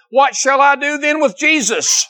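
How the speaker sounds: high, pleading question; Pilate's question. Acted. Pilate asks the crowd.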